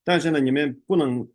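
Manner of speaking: ordinary talk, nothing marked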